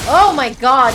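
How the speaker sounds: loud startled oh my god; startled shout. Real, not acted. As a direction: blurt it out in shock. Startled by an alchemist.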